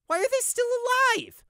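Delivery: very high, loud, odd demand. Acted. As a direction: demand answers furiously. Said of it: "Prey demanded".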